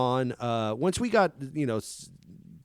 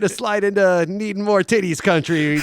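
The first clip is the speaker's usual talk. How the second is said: raised, loud mock complaint; mock viewer voice; 6 semitones higher, 5 dB louder than usual. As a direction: voice an imagined picky viewer. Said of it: "It's like".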